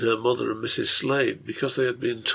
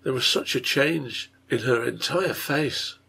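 plain reading voice

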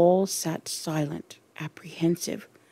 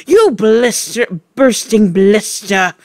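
loud furious yell; 4 semitones higher, 7 dB louder than usual. Acted. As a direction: yell the insult. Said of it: "yelled the Trunchbull".

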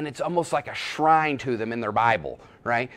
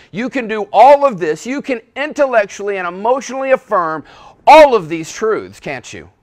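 fervent pulpit climax; preaching at full force; 6 semitones higher, 10 dB louder than usual. Real, not acted. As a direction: pound each phrase home.

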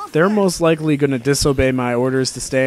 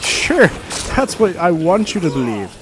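mock sinister; 4 semitones higher, 3 dB louder than usual. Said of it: "And I'm like".

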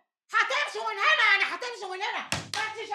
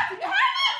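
much higher yell with a laugh; furious shout; 14 semitones higher than usual. Acted. Landlady fighting to get at the tenant, furious shout. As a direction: scream it while being held back.

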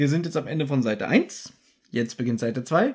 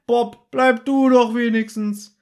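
höher und lauter, gedehnt gerufen; hinterhergebrüllt; 8 semitones higher, 5 dB louder, slower than usual. Retold or imitated. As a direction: nachgespieltes Hinterherrufen. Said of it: "Justus brüllt noch hinterher". (Justus ruft Bob hinterher, laut und gedehnt)

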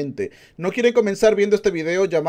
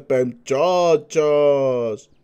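drawn-out greeting; 3 semitones lower, much slower than usual. Pretend. Stretched greeting to viewers.

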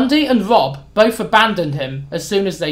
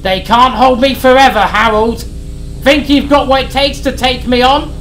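much higher, louder furious taunting; raging taunt; 5 semitones higher, 5 dB louder than usual. Acted. Sid taunts Harry as he's dragged away. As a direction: spit the threats at him. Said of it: "Sid continued to rage".

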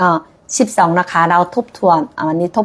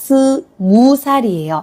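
slow, careful; 4 semitones higher, faster than usual. Shown as a demonstration. Teacher slowly spells out the tricky word for students. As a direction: split 스 from 무 살이에요 with a pause. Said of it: "อันนี้ระวังเนาะ เขียนตรงนี้ระวัง".

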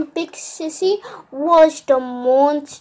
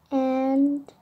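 ordinary talk, nothing marked

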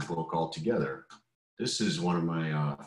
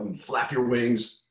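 ordinary delivery

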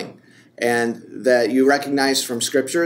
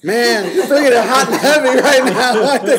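high, loud laughing praise; a real laugh while cheering her on; laughing exclaim; 11 semitones higher, 6 dB louder than usual. Real, not acted. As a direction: tease her through laughter. Reacting to partner's intensity.

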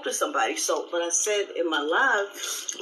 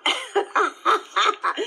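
high, louder squeaky ha-ha; chipmunk laughing; 6 semitones higher, 4 dB louder, much slower than usual. Retold or imitated. Imitating the chipmunk laugh Teresa posted, squeaky and high. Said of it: "chipmunk laughing".

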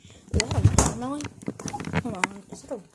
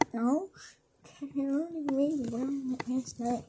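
usual speaking voice

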